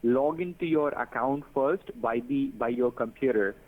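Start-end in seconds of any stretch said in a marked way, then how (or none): none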